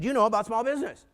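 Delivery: high, loud gossipy reveal; retold scandalized telling. Colleagues warning banker about small business, high scandalized tone.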